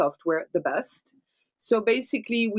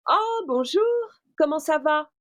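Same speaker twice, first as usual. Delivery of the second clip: bright, high French greeting, mouth wide open; French character greeting; 7 semitones higher than usual. Shown as a demonstration. Dubbing demo of a French character greeting a friend, high and bright. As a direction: voice the character's cheerful French hello. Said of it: "my character that I'm gonna be dubbing in French".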